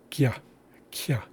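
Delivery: kya, a quick k then a y glide. Shown as a demonstration. Teacher modelling kya for learners, k glided into y. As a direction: say kya with the y sound right after the k. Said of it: "The first sound is followed by a ya sound".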